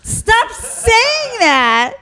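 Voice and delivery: loud, high mock fury at co-host; mock rage. Real, not acted. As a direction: yell it like an outraged victim.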